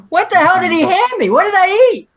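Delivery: shocked disbelief. Retold or imitated. Retelling his shock over chowder.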